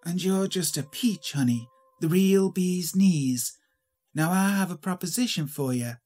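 mildly sarcastic doll, drawing out the praise. A narrator's reading. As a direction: lay on mock sweetness with a vaudeville twang. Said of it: "came out in a sarcastic hiss".

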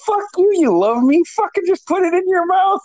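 high-pitched mock exasperation; imitating a fed-up buddy, high; retold exasperated scolding.

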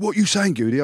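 high, challenging demand; confrontational coach. Retold or imitated. Coach confronting a player who mocked him, challenging. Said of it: "he walks over, he's like".

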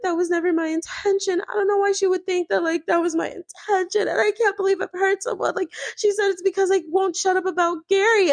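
very high, hammed-up tearful whine; mock crying Teresa. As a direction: overdo the hurt innocence. Teresa instantly starts crying.

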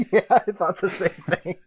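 talking through real laughter, pitched high; helpless giggly agreement; laughing. Real, not acted. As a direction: agree while cracking up. Cracking up at co-host's joke.

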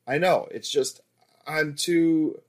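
halting, tripping over the words; stuttering. A narrator's reading. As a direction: stumble and stall mid-sentence. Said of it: "I stuttered".

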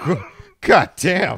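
high, loud mock exclamation; exasperated outburst. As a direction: blurt it out in disbelief. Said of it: "I was like".

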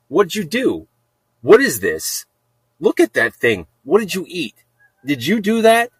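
high, incredulous mock telling-off; scolding the cat.

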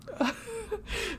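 choked tearful laugh. Real, not acted. Tearful laugh while crying.

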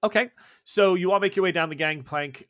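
ordinary talk

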